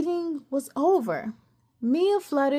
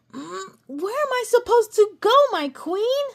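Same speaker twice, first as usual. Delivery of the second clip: high, louder cry of frustration; angry shout; 9 semitones higher, 4 dB louder than usual. A narrator's reading. Cassie shouting at the absent queen, angry and upset. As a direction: fling the question at the sky. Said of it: "she angrily shouted in the air".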